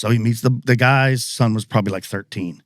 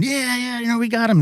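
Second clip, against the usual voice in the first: a held-back cheer way up in pitch; mock celebration; 13 semitones higher than usual. Retold father and son celebrating kill.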